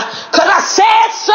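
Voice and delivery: high, drawn-out declaration; yelling. Real, not acted. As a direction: yell it slowly, every word stretched. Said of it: "me yelling".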